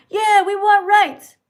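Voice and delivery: loud high slow chant of a protester; mock protester. Mocking pride marchers demanding rights, loud. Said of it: "people who are like".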